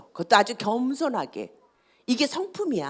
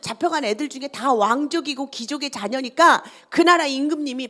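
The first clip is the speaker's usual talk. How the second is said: ordinary talk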